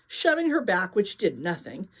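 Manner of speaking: ordinary delivery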